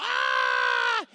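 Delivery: high, loud shriek of alarm; a frightened servant's sudden cry; loud high yelp of terror; panicked scream. Retold or imitated. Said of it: "He's freaking out".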